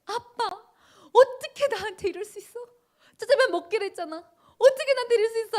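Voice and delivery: put-on high, loud wailing child's protest, rushing at the end; crying tantrum. A daughter wailing at her father over the phone, high-pitched and loud. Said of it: "울고 불고 소리를 지르면서 난리를 치는 거예요".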